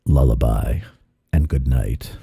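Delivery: low, soothing mock lullaby voice. Lulling himself to sleep, low mock lullaby. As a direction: croon like putting a child to bed. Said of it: "You already do that with your voice".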